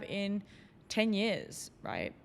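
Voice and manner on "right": ordinary delivery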